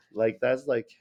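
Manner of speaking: normal talking voice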